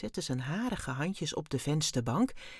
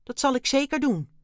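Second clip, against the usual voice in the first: loud, raised, decided reply; resolute; 8 semitones higher, 7 dB louder than usual. A narrator's reading. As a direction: declare it with firm resolve. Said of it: "zei Dexter beslist". (vastbesloten en luid)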